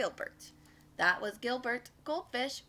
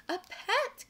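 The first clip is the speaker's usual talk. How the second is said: high, thrilled exclamation; high-pitched storybook delight; delighted fish; 9 semitones higher, 3 dB louder than usual. A narrator's reading. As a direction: squeal it as a little fish overjoyed. Fish thrilled to find a pet.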